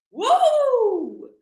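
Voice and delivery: long, very high woo; high whoop. Real, not acted. Recovering from laughing hard on a live, high whoop. Letting out hilarity after laughing.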